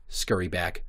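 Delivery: ordinary talk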